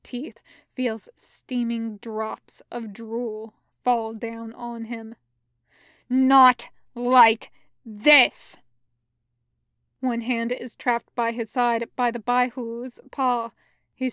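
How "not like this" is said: very slow and loud, word by word; defiant cry. A narrator's reading. Pinned fighter refuses to die, slow defiant cry. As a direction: force out each word in defiance. Defiant repeat facing the beast's teeth.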